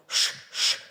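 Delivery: voiceless Ш, ш hissed softly with no voice; whispered sh. Shown as a demonstration. Teacher showing children the sound, a soft voiceless Ш, ш. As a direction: breathe out a long soft Ш, ш. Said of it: "це приголосний звук".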